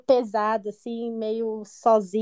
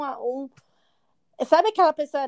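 ordinary talk, nothing marked

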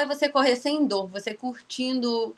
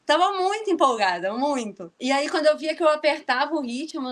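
normal talking voice

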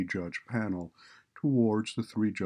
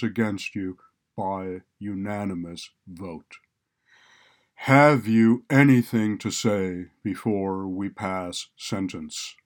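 clear, deep, resonant judge; a stern judge speaking loud and resonant; 9 dB louder than usual. A narrator's reading. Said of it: "begins to speak in a clear, deep, resonant voice".

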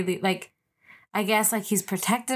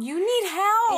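exasperated; 10 semitones higher, slower than usual. Retold or imitated. Finishing friend's exasperated quote.

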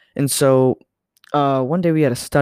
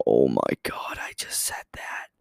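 horrified whisper, voice almost gone; shocked whisper; 12 semitones lower, 4 dB quieter than usual. Retold or imitated. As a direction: breathe it out in disbelief. Horrified at own blunder.

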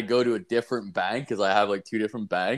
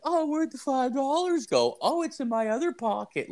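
much higher, airheaded mock voice; mock ditzy woman. Mocking the teller as a dim woman, high ditzy voice. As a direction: play a clueless woman searching her pockets.

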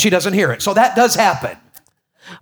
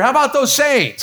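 hearty fan call; 7 semitones higher than usual. Retold or imitated. Teasing a church member.

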